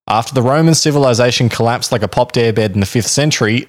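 normal talking voice

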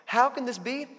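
high, incredulous repeat of the question; a bewildered listener echoing the question. Retold or imitated. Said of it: "you ask".

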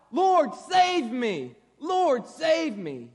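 loud, high, drawn-out plea for rescue; crying out. A narrator's reading. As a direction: call out slowly and urgently for help. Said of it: "cried out".

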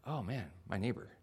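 dismayed mutter. Retold or imitated. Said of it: "I'm like".

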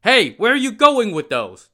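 high, loud barked challenge; soldiers barking. Acted. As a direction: bark it at the porters. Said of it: "the soldiers barked".

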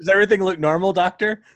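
high, sing-song teasing question; playful mock question. Acting out her question to doctor.

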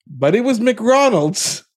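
"McRonald's" drawn out and pitched high; stress on McRonald's. Real, not acted. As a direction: land slowly on "McRonald's" as the punchline. Punchline correcting the misheard restaurant name.

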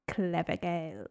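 put-on low, soft and slow "Clever Girls"; hushed, drawn-out "Clever Girls"; Muldoon-style delivery. Shown as a demonstration. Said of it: "I just want us to say it like".

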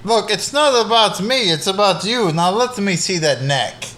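high, innocent vampire voice; vampire playing dumb. Vampire dodging the donor's question, high and coy. Vampire deflecting, wants neck.

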